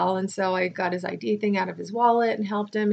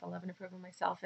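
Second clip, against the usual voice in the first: "I love and approve of myself" murmured much softer, faster; murmured affirmation; 2 semitones lower, 17 dB quieter, faster than usual. Shown as a demonstration. Repeating her affirmation to herself, softer murmur.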